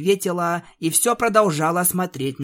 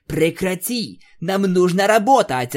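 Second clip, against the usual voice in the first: louder angry command; angry snap; 5 semitones higher, 5 dB louder than usual. Acted. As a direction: cut her off angrily. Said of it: "разозлилась Аланис". (злой окрик)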